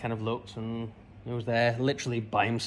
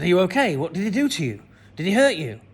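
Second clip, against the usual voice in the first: loud, high, urgent worried questions; a father frightened for his son; alarmed father; 6 semitones higher, 7 dB louder, faster than usual. Retold or imitated. Dad questions his son about the stranger, loud and alarmed.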